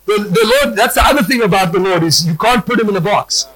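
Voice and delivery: ordinary delivery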